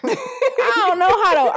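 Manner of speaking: cracking up at herself; laughing. Real, not acted. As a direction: giggle out the confession. Laughing admission she can't run.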